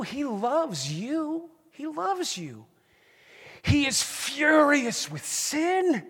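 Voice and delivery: slow, soaring preacher cry of love and fury; impassioned preaching. Real, not acted. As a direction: plead with the congregation, rising in fervour. Preaching at full force.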